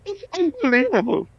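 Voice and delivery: high, far-off mock groan of disbelief; exasperated photographer. Photographer mocked as fed up with the singer's big head. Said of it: "And he goes, he's holding the camera".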